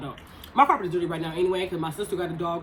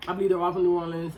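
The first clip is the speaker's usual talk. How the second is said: ordinary talk, nothing marked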